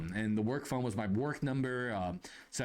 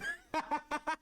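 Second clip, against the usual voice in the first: high, giggly burst of laughter; real laugh. Real, not acted. Storyteller amused by the naked customer, laughing. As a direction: crack up at your own story.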